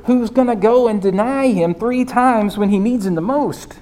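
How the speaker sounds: usual speaking voice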